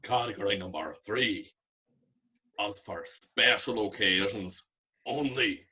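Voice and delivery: put-on slow drunk Belfast voice, shouted good, whispered shite; imitating a drunk Belfast man's rating system; a drunk Belfast co-judge ranking competitors, Belfast accent. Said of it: "This is what he sounded like at this hour".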